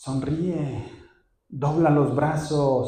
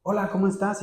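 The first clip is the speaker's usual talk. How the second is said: a higher, offhand mock greeting; curt greeting; 6 semitones higher, 3 dB quieter than usual. Pastor mimicking a brusque hello, lightly mocking. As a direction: toss it off like a quick hello in passing. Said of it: "Alberto me saludó nada más".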